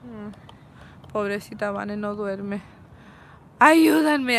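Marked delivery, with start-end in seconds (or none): 3.6-4.4 louder, hammed-up cry for help; mock plea. To her friends, a mock cry for help. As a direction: beg the friends for help, half joking. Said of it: "No, just kidding".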